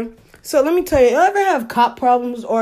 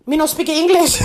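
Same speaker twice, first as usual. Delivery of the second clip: mock broken English; 5 semitones higher than usual. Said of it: "all of that role playing".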